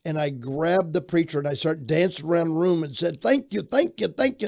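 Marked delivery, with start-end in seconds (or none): 3.2-4.5 high-pitched, excited string of thank-yous; retold elated thanks. Retold joyful thanks while dancing.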